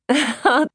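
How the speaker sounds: short amused laugh; a quick bright giggle; light laugh. A narrator's reading. Trudy laughs.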